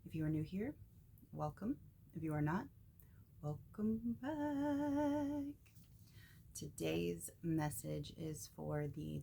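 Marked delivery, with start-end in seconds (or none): 3.4-5.5 high, sustained, stretched-out "back"; slow, playful high-pitched hold; drawn-out singsong greeting. Real, not acted. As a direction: sing out the greeting, holding the last word way up high. Playful drawn-out greeting to returning viewers.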